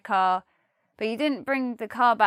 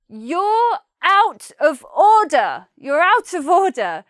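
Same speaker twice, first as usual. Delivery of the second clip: loud, higher, slow telling-off; serious rebuke; 5 semitones higher, 8 dB louder, slower than usual. Shown as a demonstration. Teacher showing a serious rebuke in a pub row. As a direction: stand up to someone who crossed the line. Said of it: "this is when things get serious".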